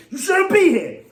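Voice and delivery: sudden high heated outburst; worked up. Real, not acted. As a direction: fire it at the haters, loud and high. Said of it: "Let me chill, man".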